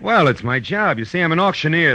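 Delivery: high, quick cover story; nervous bluff. Acted. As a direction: bluff fast under the gun. Detective bluffing at gunpoint.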